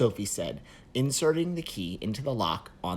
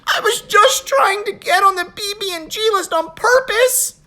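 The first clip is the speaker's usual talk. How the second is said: high, loud crying plea; wailing; 12 dB louder than usual. Acted. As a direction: wail in despair. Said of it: "Chris wailed".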